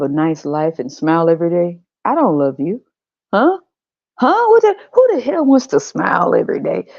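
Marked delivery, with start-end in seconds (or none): 3.3-6.9 incredulous scoff. Acted. God mocking happiness.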